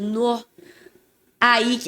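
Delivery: ordinary delivery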